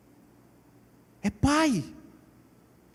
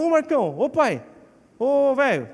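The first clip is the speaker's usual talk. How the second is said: higher, familiar hail to dad; casual intimacy; 5 semitones higher, 4 dB louder than usual. Acted. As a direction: call out to your old man informally. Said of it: "Eu tenho intimidade com meu pai". (chamando o pai com intimidade, bem informal)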